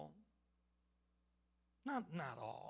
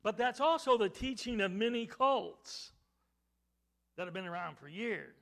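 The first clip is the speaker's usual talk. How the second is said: loud, raised-pitch preaching; forceful preaching; 6 semitones higher, 12 dB louder than usual. Real, not acted. Preacher warning the congregation about cult teaching, forceful preaching. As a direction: hit every word hard from the pulpit.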